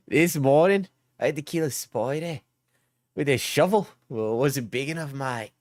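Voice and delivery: broad Queensland Aussie storytelling; Queensland accent. Pretend. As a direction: spin a yarn in a put-on Queensland accent. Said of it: "it's more of a Queensland accent".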